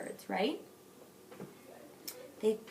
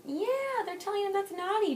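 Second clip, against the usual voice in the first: excited; 11 semitones higher, 6 dB louder than usual. Real, not acted. Excited praise to child.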